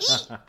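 squeaky bird sneeze. Retold or imitated. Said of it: "like a little bird goes".

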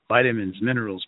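usual speaking voice

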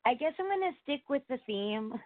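ordinary delivery

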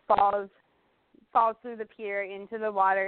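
ordinary delivery

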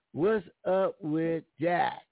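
ordinary talk

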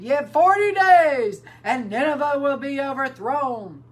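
booming public proclamation, pitched high; crying out. A narrator's reading. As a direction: cry it out as a warning to a whole city. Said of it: "he cried and said".

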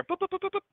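high beeping dial tones; phone dial beeps. Retold or imitated. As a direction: beep out the phone number. Imitating phone dialing beeps.

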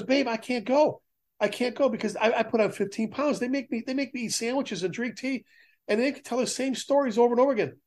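playfully fake-complain to his wife about being spoiled; mock complaining retiree. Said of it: "he goes".

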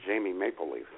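ordinary delivery

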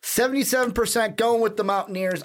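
matchup announcer. Acted.

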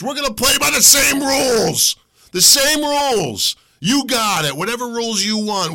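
shouted challenge to the FBI; defiant shout. Real, not acted. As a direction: hammer the same rules home.

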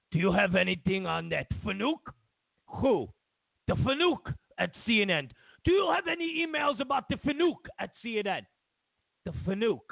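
put-on insistent comic Russian accent, pitched high; Vladimir Putin. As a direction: press for it in a hammed-up Russian accent. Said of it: "you don't do a good Russian accent".

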